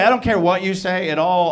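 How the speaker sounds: normal talking voice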